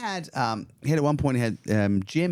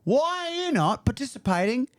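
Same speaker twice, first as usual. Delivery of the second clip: higher, indignant customer complaint; mock angry customer. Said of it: "people would then kick off".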